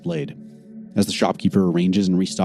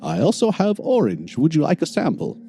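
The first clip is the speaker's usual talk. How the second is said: shopkeeper voice; 9 semitones higher than usual. Acted. Shopkeeper offers sample.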